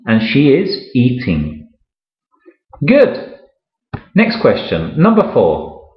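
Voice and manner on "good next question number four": usual speaking voice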